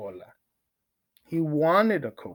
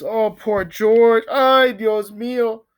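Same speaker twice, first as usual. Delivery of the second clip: slow, high, overdone sympathy; mock pity; 7 semitones higher, 5 dB louder than usual. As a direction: pity poor George with a show of sorrow.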